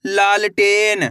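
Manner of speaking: लालटेन stretched out slowly, syllable by syllable; लालटेन slowly drawn out; slow blended word. Shown as a demonstration. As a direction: draw out लालटेन so each part is heard. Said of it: "कैसे पढ़ेंगे?".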